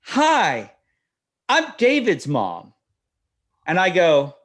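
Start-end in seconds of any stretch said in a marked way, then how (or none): 0.0-2.7 high, drawn-out friendly mom voice; chirpy mom greeting. Retold or imitated. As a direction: imitate an over-friendly mother introducing herself. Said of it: "She goes".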